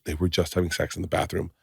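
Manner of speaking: soft, understated imitation of a child tattling; retold confiding. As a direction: drop the voice as if passing on a secret. Retold child passing on a secret.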